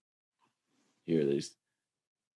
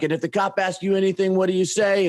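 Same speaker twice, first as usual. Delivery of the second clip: much higher, fast quizzing voice; mock lecture; 10 semitones higher, faster than usual. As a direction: fire the question like a pushy host. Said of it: "He's like".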